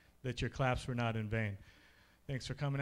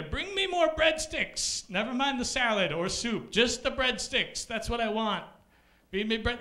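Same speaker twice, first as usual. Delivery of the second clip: greedy diner; 8 semitones higher than usual. Retold or imitated. Comic playing a diner bossing the Olive Garden waiter. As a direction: order like a spoiled glutton.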